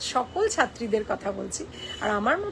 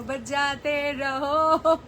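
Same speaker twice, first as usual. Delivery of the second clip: "বাজাতে রহো" very slow, each syllable stretched in a wailing call; slow drawn-out blessing; much slower than usual. Shown as a demonstration. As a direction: drag out "বাজাতে রহো" like a mock chant. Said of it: "ওরকম আস্তে আস্তে বলবো". ("বাজাতে রহো" খুব আস্তে আস্তে টেনে টেনে বলা)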